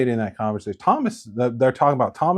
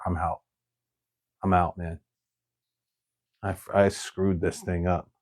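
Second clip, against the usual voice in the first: lower, soft defeated mumble; dejected quitting; 7 semitones lower, 6 dB quieter than usual. Retold or imitated. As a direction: give up quietly. Peter gives up, dejected.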